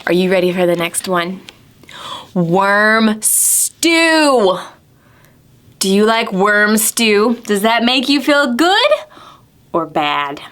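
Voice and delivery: ordinary talk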